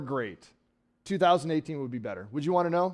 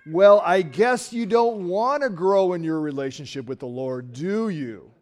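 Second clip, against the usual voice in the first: mock passive-aggressive, slow and higher; 3 semitones higher, 6 dB louder, slower than usual. Preacher mimicking a passive-aggressive pastor guilting the flock. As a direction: needle them sweetly. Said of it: "passive-aggressive approach".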